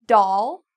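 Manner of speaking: doll said with a wide open ah. Shown as a demonstration. Teacher showing learners doll with a big open mouth. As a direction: drop the jaw for doll. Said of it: "notice how big my mouth gets".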